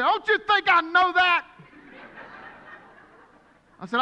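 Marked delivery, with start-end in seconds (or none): 0.0-1.5 exasperated retort. Retold or imitated. Retorting to the nurse.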